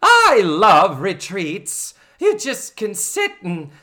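high, loud, very slow character voice; hammed-up sing-song matron; Mrs. Garrett impression. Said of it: "gotta give her a joke".

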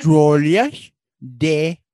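put-on loud, goofy French accent; Adam Sandler being French. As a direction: belt out bad comic French. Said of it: "my Adam Sandler being French impression".

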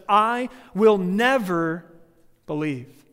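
"I will never" slow and loud, never punched; Thomas's flat refusal, "never" leaned on hard; emphatic never. Retold or imitated. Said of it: "listen to how emphatic this is".

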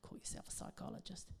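whispered, grumbling aside; sulky whisper. Retold or imitated. Alone with the diary, whispered sulky grumble. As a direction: mutter it under your breath, sulking. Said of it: "I was like".

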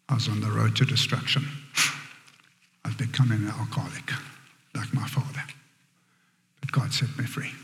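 very soft and low, near-whisper; hushed testimony. Real, not acted. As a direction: confide it almost under the breath.

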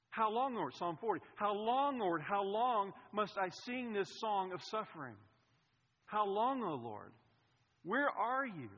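loud psalm lament, pleading; anguished cry to God. Pretend.

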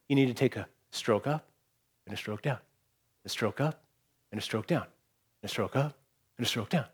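hushed, low, measured phrases with pauses; patient master instructing. Acted. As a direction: softly count out each movement like a patient master. Master demonstrates slow painting strokes.

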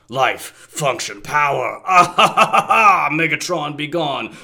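slow villain voice, bursting into a laugh; lightly voiced gloating tyrant; Galvatron voice. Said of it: "His voice is deeper, different from Megatron's".

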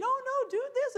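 high, sweet little angel voice; little cartoon angel. Cartoon angel on the shoulder pleading, high and sweet. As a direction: squeak it like a tiny cartoon angel. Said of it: "the angel saying".

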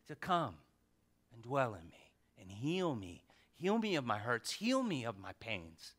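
soft, low plea for healing; hushed prayer. Pretend. Preacher showing the church how to pray, hushed tender plea. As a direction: pray it quietly, almost inward. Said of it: "And you say".

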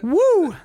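high loud woo shout. Real, not acted. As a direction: whoop it out high. Reacts to loud moment.

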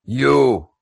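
drawn-out callout; single out the bartender, stretched. Acted. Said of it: "it's a little louder too".

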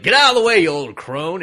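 big high, loud mock bellow; gruff-hero shout. Hero ordering an old woman aside, hammed-up angry shout. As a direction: yell it across a battle. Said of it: "And he's like".

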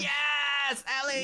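high, loud delighted squeal; excited cheer. Real, not acted. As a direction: cheer her name with joy.

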